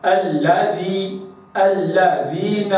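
"الذي" and "الذين" slow, the shadda on the lam split clearly; doubled lam. Shown as a demonstration. Teacher reciting "الذين" with the doubled lam drawn out. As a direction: separate the two lams in "الذي". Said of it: "هكذا".

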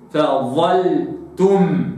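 فظلتم very slow, tongue out, heavy ẓā; fully emphasize the ẓā in فظلتم; فظلتم, not فذلتم; tongue out, emphatic. Shown as a demonstration. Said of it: "لابد أن أخرج اللسان وأفخمها".

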